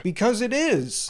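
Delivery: imitated fan answer. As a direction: stretch it out as a pat answer. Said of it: "they'd give the same answer".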